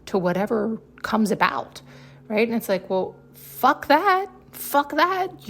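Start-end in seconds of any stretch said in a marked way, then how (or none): 3.3-5.5 defiant outburst. Acted. Telling listeners to reject being dragged around, high defiant outburst. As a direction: spit it out like you're done with it.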